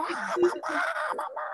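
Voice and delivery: high mock bawl; hammed-up, high-pitched whimpering wail; imitated wailing for mum. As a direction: call for mum like a crying child. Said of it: "así como".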